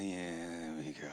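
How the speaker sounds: low, soft half-hearted cheer; unenthused. Retold or imitated. Bored crowd member mumbling a lazy cheer. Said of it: "some of y'all like".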